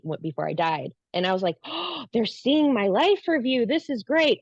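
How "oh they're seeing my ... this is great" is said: high, drawn-out delight with a gasped "oh"; retold excitement. Woman watching her life review, retold excitement. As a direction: recall being thrilled, pitched high and slowed.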